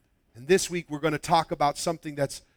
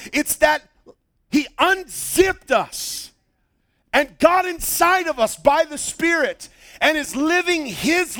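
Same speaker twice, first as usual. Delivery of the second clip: raised pitch, hard-hit key words; full-force preaching; 7 semitones higher, 5 dB louder than usual. Real, not acted. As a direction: drive it home to the congregation, drawing out the big phrases.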